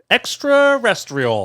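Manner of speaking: high, loud mimicry of "Extraterrestrial"; mocking repeat. Host teasing co-host over a stumbled word, mocking.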